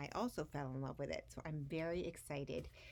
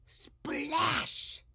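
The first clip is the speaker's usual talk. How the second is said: loud, high voiced splash; playful splash effect; splash sound; 11 semitones higher, 10 dB louder than usual. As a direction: shout the splash big. Wave sound in story.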